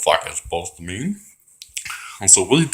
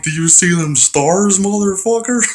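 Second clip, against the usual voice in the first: gloating taunt; 9 semitones higher, 4 dB louder than usual. Retold or imitated. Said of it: "I was like".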